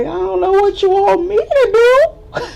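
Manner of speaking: high, loud helpless cry; panicked high plea. Retold or imitated. Narrator helpless during the street fight, high panicked voice. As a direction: throw up your hands in panic.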